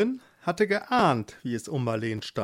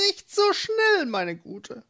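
sly witch. Acted. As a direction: stop her with sweet menace. Witch stops her.

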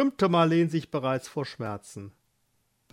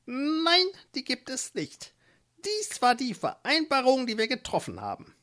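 much higher, firm witch's refusal; hard witch; 10 semitones higher than usual. Acted. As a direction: refuse flatly, no mercy.

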